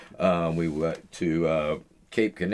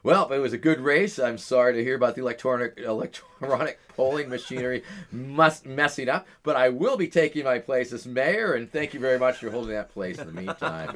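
raised-pitch put-on candidate speech; higher, hammed-up public politeness; mock winner's address; 5 semitones higher than usual. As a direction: play the self-declared mayor addressing the loser. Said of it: "shook his hand and told him".

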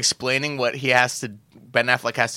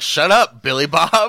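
loud, higher imitation of snapping back; mock angry retort; 5 semitones higher, 7 dB louder, slower than usual. Driller telling Billy Bob to shut up, mock angry shout. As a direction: cut him off with a hammed-up shout. Acting out a gruff dismissal.

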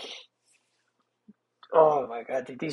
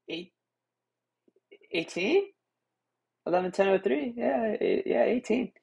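embarrassed stammer; 6 semitones higher than usual. Retold or imitated. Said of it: "I was all embarrassed".

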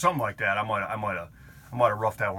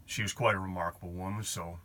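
normal talking voice

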